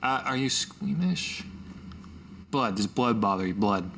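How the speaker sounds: ordinary talk, nothing marked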